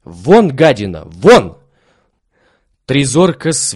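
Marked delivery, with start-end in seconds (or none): 0.0-1.6 furious shout, much louder. A narrator's reading. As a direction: drive the dog out yelling. Servant chasing dog out.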